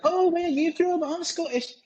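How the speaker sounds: put-on very high-pitched Scottish accent; Scottish girlfriend. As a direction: mimic a Scottish woman in a squeaky-high voice. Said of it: "his girlfriend was Scottish".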